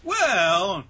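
loud, high, drawn-out opener; flashback lead-in. Retold or imitated. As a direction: stretch it out theatrically to launch a story.

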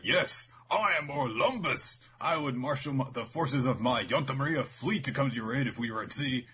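hammed-up heroic proclamation; pompous commander. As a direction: announce yourself grandly, drawing out the name. Voicing the pompous fleet commander.

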